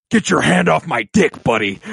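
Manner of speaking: loud, tough-guy growl; Patrick Swayze voice. Retold or imitated. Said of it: "goes into his Patrick Swayze voice and goes".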